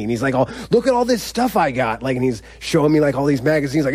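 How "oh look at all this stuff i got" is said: higher-pitched, eager show-off voice; imitating excited. Older cellmate shows newcomer his stuff, imitated excitement. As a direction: play a cellmate eagerly showing off his things. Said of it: "he was just so excited to have company".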